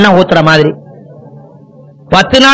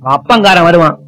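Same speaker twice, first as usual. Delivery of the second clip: ordinary delivery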